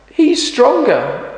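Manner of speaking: put-on very high, slow little girl. Girl blaming the stronger boy, high and slow. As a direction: point at him, high and slow. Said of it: "says the girl".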